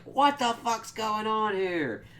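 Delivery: high, drawn-out comic disbelief; mock bewilderment. Voices Jonah's bewildered look.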